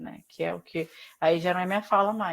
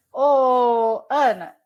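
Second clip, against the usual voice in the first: loud, drawn-out call to get attention; 3 semitones higher, 12 dB louder, much slower than usual. Real, not acted. Teacher hailing a student by name, calling out. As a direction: call out loudly, stretching the Ô.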